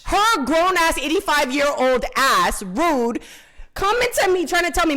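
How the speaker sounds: slow, loud, high indignation; indignant rant. Real, not acted. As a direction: spit it out indignantly. Indignant rant about the woman.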